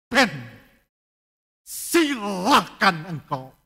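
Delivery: slow, loud solemn voice of God; God's voice. Acted. Pastor voicing God giving up on a sinner, slow loud solemn. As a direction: let them go in a grave verdict. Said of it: "Tuhan katakan apa?".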